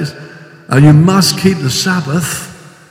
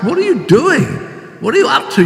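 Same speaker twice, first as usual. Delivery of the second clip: exasperated scolding; 10 semitones higher, faster than usual. Retold or imitated. Paul scolding the Galatians.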